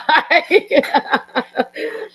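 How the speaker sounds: high, loud laugh as the tears come; a laugh that tips into crying; tearful laugh. Real, not acted. Mother breaking down at son's memorial, laughing. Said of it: "I'm gonna cry".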